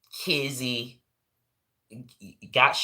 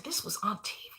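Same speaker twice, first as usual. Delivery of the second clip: whispered in disbelief; husband whispering in shock; husband's whisper; 7 semitones higher, 12 dB quieter than usual. Retold or imitated. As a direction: breathe it like he can't believe it. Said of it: "he said".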